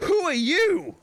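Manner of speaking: high startled who are you; startled gasp. Acted. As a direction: gasp and ask high, slow. Player voices game girl character.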